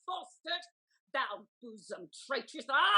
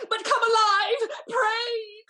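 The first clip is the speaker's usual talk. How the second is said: higher, louder half-sobbing plea; tearful plea; 6 semitones higher, 7 dB louder, slower than usual. Acted. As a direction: plead through tears. Lady begging him come back alive.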